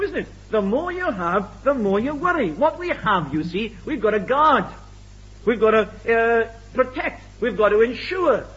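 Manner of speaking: ordinary delivery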